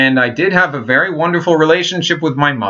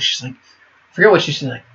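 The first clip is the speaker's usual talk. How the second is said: ordinary talk, nothing marked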